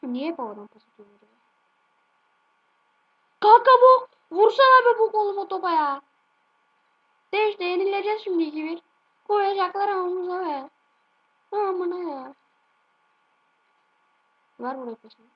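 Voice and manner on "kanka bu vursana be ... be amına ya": high, loud frustrated shouting; furious gamer rant; angry yelling at teammates. Real, not acted. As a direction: yell at the useless teammate.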